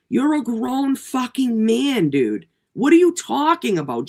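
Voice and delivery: ordinary delivery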